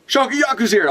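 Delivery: high, hammed-up alarm at "Shakuyaku's here!"; mock panicked shout. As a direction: announce "Shakuyaku's here!" like a frightened pirate. Pirates panicking at her arrival.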